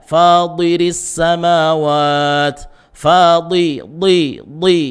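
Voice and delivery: "Fadiris" with thick Arabic ta in place of a light t; thick emphatic t. Shown as a demonstration. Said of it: "pangkal lidah naikkan".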